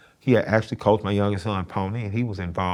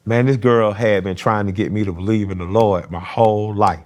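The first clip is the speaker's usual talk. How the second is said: ordinary talk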